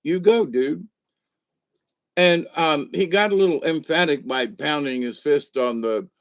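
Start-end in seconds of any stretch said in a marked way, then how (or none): 0.0-0.9 higher, lightly mocking cheer; cheering him on. Reacting to the Ted Cruz clip, a mild mock cheer. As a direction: egg him on like a fan. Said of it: "And I was like".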